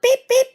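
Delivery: high, loud whistle toots; cheerful engine whistle; engine whistle beep. Acted. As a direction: toot like a little engine. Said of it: "he whistled".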